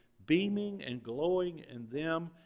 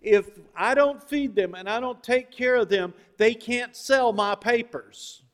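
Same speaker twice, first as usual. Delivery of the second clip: loud, emphatic, higher-pitched declaration; newspaper boss laying down terms; 9 semitones higher, 16 dB louder than usual. Retold or imitated. Newspaper tycoon on feeding his newsies, loud and insistent. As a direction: lay down the rule forcefully. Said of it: "because he said this".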